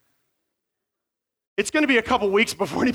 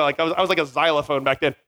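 ordinary talk

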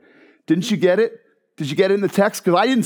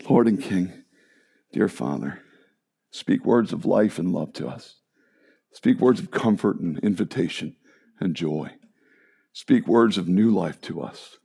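prayer; 8 semitones lower than usual. Acted. Pastor leads closing prayer.

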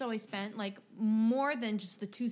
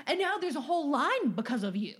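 louder, higher dismissive rant ending in blah blah; mock angry customer; 5 semitones higher, 5 dB louder than usual. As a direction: brush her off loudly like an entitled customer. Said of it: "he says".

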